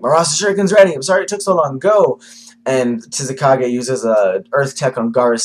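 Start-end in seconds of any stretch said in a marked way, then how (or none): 0.0-2.2 high, loud eager cry; eager battle shout. Retold or imitated. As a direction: call it out like an excited hero. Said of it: "he's like".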